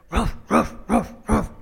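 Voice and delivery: mock dog bark, ruff ruff. Dog barking at its owner in the joke.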